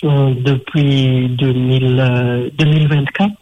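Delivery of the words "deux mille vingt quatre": usual speaking voice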